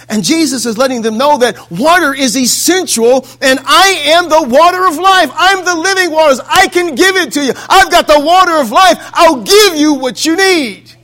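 high, loud sermon cry; impassioned preaching. Acted. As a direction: preach it out as a bold proclamation. Preaches as Jesus speaking.

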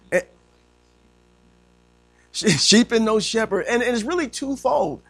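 high, emphatic sermon delivery; impassioned preaching. Real, not acted. Preacher explaining to congregation why Jesus wept, impassioned.

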